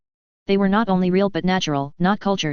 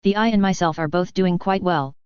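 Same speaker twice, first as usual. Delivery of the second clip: usual speaking voice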